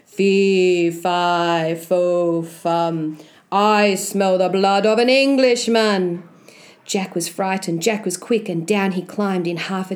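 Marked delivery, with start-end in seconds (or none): 0.0-3.2 big deep voice. Acted. Giant above Jack's head, deep rumbling chant. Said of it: "he heard a big, deep voice". 3.5-6.2 menacing giant's rumbling boom; big deep voice. Acted. Giant sniffing out an Englishman, deep booming threat. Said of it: "It shouted loud".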